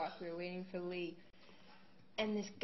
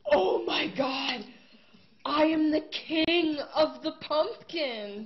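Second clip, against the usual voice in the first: slow, high, much louder proclamation; mock crazed shout; 8 semitones higher, 11 dB louder, slower than usual. Stranger spots the pumpkin sweatshirt, shouting slowly and grandly. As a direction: bellow it like a madman crowning himself.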